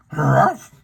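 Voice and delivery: a loud single woof; triumphant dog bark. Acted. Scooby barks in triumph over his bone, loud woof.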